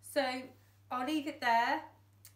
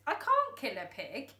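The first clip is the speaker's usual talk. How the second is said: higher, louder protest; shouted; 4 semitones higher, 6 dB louder than usual. Acted. Boy refusing to run over the pig, shouted. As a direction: refuse in horror. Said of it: "shouted the boy".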